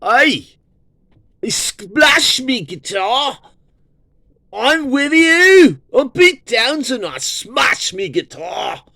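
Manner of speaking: shrill high cartoon Cockney rocker. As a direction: squeal it like a silly British guitarist. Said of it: "the Who are like".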